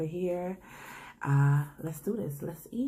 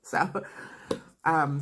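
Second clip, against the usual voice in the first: ordinary talk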